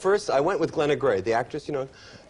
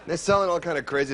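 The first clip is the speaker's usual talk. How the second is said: usual speaking voice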